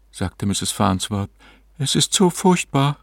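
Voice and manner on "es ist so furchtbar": high woman's character voice; overwhelmed séance client. A narrator's reading.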